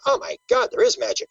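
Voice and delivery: high-pitched astonished exclamation; retold amazement. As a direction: relive the awe, pitch leaping up. Relives his amazement at sighting.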